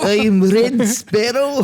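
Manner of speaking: slow, high put-on Russian accent; Russian accent parody; mock Russian spy. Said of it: "Jennifer Lawrence going".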